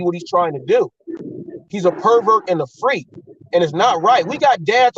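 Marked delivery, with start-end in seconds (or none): none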